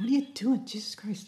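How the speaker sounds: teasing impression that trails into muttering; imitating the co-host. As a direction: mimic your friend, ending under your breath. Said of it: "if I was doing you, I'd say".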